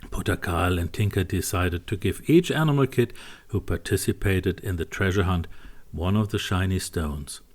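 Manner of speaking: plain reading voice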